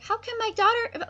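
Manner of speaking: put-on very high girlish voice; Miranda's voice. Miranda protests to her father, very high. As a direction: squeak it like a young girl. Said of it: "Miranda's like".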